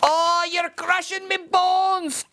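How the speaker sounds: high, slow pained cry; squeezed leprechaun. Acted. Leprechaun cries out as Seamus squeezes him, high and pained. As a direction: wail as if being crushed. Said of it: "cried the leprechaun".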